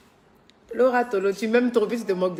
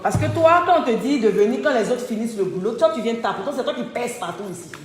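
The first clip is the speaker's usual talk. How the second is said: scolding; 2 semitones higher, 6 dB louder than usual. Real, not acted. Scolding a worker about the mess, loud. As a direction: tell him off loudly.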